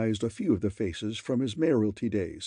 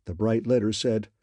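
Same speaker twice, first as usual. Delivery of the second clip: ordinary delivery, nothing marked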